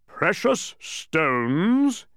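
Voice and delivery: high, loud, hugely stretched roar. A narrator's reading. Mathematician answering Milo's treasure question, incredulous roar. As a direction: roar it in disbelief, drawing out each word. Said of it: "he roared, even louder than before".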